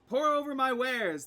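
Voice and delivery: high, loud hostess voice; inviting shopkeeper. Acted. Shopkeeper invites customers to browse, high inviting voice.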